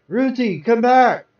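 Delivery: high, loud cry after her; desperate cry. Acted. Husband held down, crying out loud for his wife. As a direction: call out as they wheel her away.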